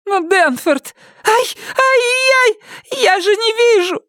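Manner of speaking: a beaten wife crying out; sobbing cry of pain. A narrator's reading. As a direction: wail through tears. Myrtle crying out.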